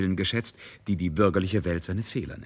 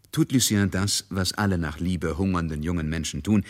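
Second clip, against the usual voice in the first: ordinary talk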